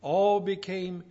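loud, punched list from the pulpit; forceful preaching. Real, not acted. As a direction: hammer each phrase out to the congregation.

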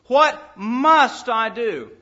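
"must" drawn out and leaned on, slow; slow, heavy "must"; stress on must. Shown as a demonstration. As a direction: hit the word "must" hard and slowly. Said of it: "stressing must".

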